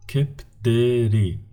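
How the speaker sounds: Kepderi said slowly, syllables apart; slow demo of Kepderi; slow model word. Shown as a demonstration. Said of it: "the words with the three syllables".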